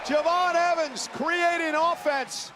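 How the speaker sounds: excited high play-by-play shout; wrestling commentator. Real, not acted. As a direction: call the big move, thrilled. Live wrestling play-by-play climax.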